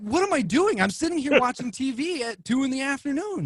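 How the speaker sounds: high, bewildered retelling; mock confusion. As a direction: pitch up in disbelief. Retold confusion over accusation.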